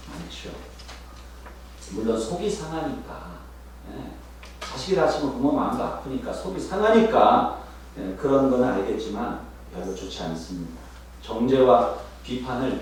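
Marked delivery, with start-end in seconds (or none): none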